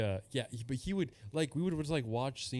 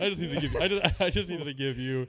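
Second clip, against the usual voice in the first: higher, louder, real laughing speech; talking through laughter; 4 semitones higher, 5 dB louder than usual. Real, not acted. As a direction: struggle to get words out while laughing. Talking through his own laughter.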